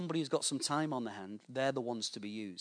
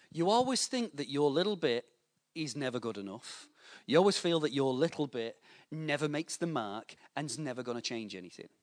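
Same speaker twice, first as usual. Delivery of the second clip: ordinary talk, nothing marked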